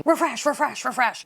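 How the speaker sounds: high repeated mock shout of refresh; mock yell. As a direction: chant refresh like a live-stream host. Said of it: "a lady just yell at me".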